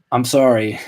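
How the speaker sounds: usual speaking voice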